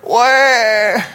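high, drawn-out fake cry; mock whiny wail. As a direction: wail like a tattling child, hammed up. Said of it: "in this frustrated tone".

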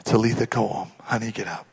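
low, soft, fading to a whisper; gentle, simple. Acted. Jesus simply waking the girl, soft and gentle. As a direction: understate it tenderly, no show. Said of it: "he just said".

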